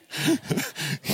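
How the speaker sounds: airy laugh at his own joke; breathy high laugh. Real, not acted. Pastor laughing at his own joke with the congregation. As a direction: let a laugh escape.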